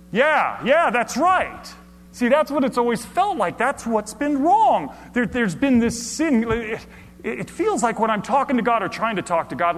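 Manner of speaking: high, loud excited sermon; fervent preaching. Acted. Preacher recounting a hopeful realization, fervent. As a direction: preach it with rising conviction.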